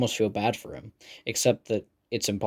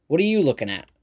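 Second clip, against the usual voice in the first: snaps; 5 semitones higher, 3 dB louder, faster than usual. A narrator's reading.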